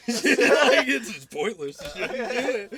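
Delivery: cracking up while talking; real laughter through the words; laughing. Real, not acted. Telling a friend about the movie, laughing. Laughing retelling the smug dad.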